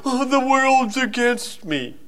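slow, high self-pitying whine; pouty whine. Retold or imitated. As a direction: whimper it like a sulking child. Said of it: "gets pouted and say".

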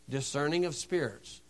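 a soft echo on "Discerning of spirits"; quiet repeat. Shown as a demonstration.

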